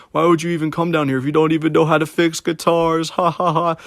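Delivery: mocking laugh. Old guitar shop owner laughing at a teen job seeker, high mocking jeer. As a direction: ridicule him and cackle at the end. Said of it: "He was just laughing at me".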